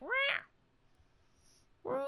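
high, soft mock yowl; short high-pitched imitated "rah"; imitated annoyed pet cry. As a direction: mimic a grumpy animal's squawk, up high. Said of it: "He sounds it. He's like".

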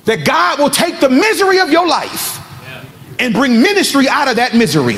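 emphatic preacher's proclamation with high peaks; impassioned preaching. Real, not acted. Preacher declaring the story is not over, impassioned preaching. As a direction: proclaim hope to the congregation.

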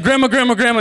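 put-on urgent, high, loud kid calling; excited, pestering shout; waking grandma. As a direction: rapidly call to wake a sleeper. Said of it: "I woke Grandma up".

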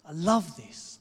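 delighted exclaim. Real, not acted. Preacher delights in Jesus coming home, loud joy. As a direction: let the delight spill out.

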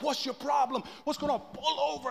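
mock road rage. Husband challenges a driver, mildly hammed-up road rage.

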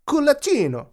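culaccino sung out high in a hammed-up Italian accent, hand bunched; mock Italian. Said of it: "this helps with the pronunciation".

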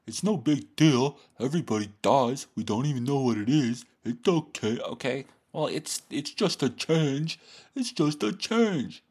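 high, put-on voice brushing worries aside; mock Stoic reassurance. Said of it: "They're just like".

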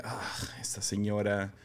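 quiet unvoiced aside; whispering. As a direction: whisper it under your breath. Man reacting to the exorcist.

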